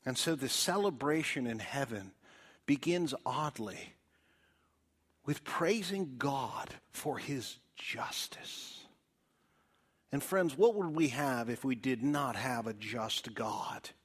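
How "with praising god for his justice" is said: soft, slow whisper. Real, not acted. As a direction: drop into a hushed whisper. Hushed aside on God's justice.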